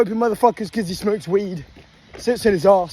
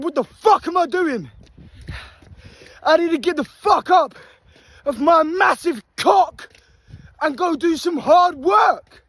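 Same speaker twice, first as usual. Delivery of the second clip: self-pep shout; 6 semitones higher than usual. Retold or imitated. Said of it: "I said".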